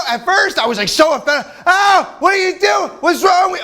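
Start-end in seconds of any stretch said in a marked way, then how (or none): 1.7-3.6 shocked outcry. Retold or imitated. Comic shouting at guests throwing money, high and offended.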